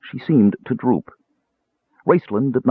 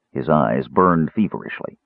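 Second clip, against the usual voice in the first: plain reading voice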